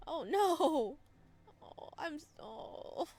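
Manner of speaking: loud, high wail; high, loud anguish; pained oh no. Real, not acted. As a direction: cry out in sympathy. Pained reaction to rejection.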